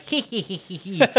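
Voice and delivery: high nasal witch cackle. Shown as a demonstration. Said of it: "try talk like a witch".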